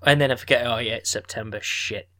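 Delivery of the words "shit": ordinary delivery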